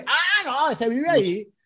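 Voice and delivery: high-pitched "oh, him" reaction; retold recognition. Retold villagers recognising nickname. (reacción aguda de reconocimiento, imitada)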